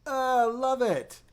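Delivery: high, slow, drawn-out "love it"; mock delight. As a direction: act out falling for a new switch on the first press. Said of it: "you press it and you go".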